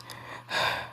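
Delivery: breathy exhale of a wounded hero; weary sigh. Acted. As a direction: let out a quiet, tired breath. Wounded hero pauses before speaking.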